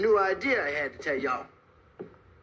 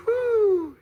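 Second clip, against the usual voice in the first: loud, high, held "whoo"; high whoop; 6 dB louder than usual. Retold or imitated. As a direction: let out a sustained high whoop. Acts out shifting lanes once more.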